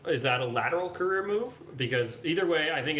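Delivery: loud, high, cheery reading to viewers; upbeat streamer. Real, not acted. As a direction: hype up the viewer. Reading viewer comments live.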